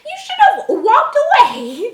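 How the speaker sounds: high, loud sarcastic mother voice, laughing through it; mock her, giggling as you go. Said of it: "you're just gonna".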